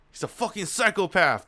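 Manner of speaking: stunned, punchy reaction; worked-up outburst. Real, not acted. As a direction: blurt it out, loud and high. Streamer reacting to the story's reveal.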